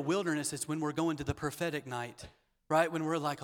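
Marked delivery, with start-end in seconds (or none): none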